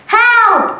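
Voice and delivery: high, loud cry of Help!; shouting for help. Acted. Child in a nightmare shouting for help. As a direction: yell it out in panic. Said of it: "In vain you're shouting".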